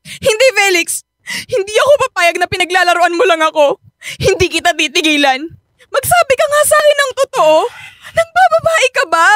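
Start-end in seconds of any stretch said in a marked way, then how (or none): 5.9-9.3 higher with each question; pushing harder for the truth; rising demand. Acted. Wife demanding if her husband cheats, rising angrily.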